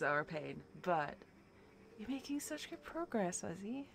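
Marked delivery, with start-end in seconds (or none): 2.0-3.8 amazed. Real, not acted. Amazed at a friend's progress.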